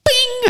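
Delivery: very high ringing "bing"; high-pitched drawn-out ping noise; imitated metallic clang. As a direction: mimic metal pinging off a pole. Imitating gun hitting the pole.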